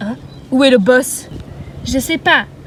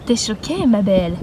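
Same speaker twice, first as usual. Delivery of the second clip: ordinary talk, nothing marked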